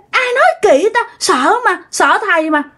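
put-on high, protesting kid voice; imitated child squirming out of trouble; backpedalling child. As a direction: deny it hastily like a scared child. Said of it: "Nói vậy các bạn theo kiểu chói".

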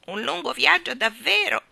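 usual speaking voice

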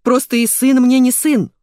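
loud, high-pitched outrage; acted indignant yell. A narrator's reading. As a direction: shout in bitter disbelief. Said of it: "заорал он".

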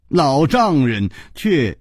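usual speaking voice

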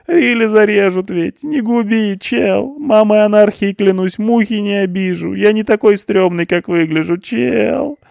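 very high, loud tearful whine; mock sobbing plea. A narrator's reading. Punk begs not to be killed, high tearful plea. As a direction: plead as if in tears.

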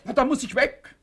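high and loud, losing control; panicked outburst. Acted. Cornered husband shouts at his wife in panic. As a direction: blurt it out in alarm.

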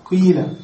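قيل with a clear long ee; full kasra. Shown as a demonstration. Teacher showing the common form, قيل with long ee. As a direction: say قيل as qīla, pure ee vowel. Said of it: "ننقل الكسر إلى القاف ثم نبدل الواو ياء".

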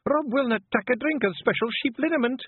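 high, cross Scots wife; snapping. A narrator's reading. Wife reporting her husband to the clan, snappish. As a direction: announce it in sharp indignation. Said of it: "snapped the Kelder".

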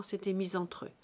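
ordinary delivery

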